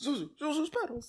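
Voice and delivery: high, soft, sobby echo; mock-tearful George. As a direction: whimper it in hammed-up joy. Echoing George's joyful discovery.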